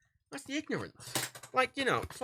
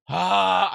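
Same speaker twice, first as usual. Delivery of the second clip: loud, higher cry of frustration; exasperated yell; 4 semitones higher, 11 dB louder than usual. Real, not acted. Vlogger fed up mid-rant about America, exasperated yell. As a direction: let out a loud groan of outrage.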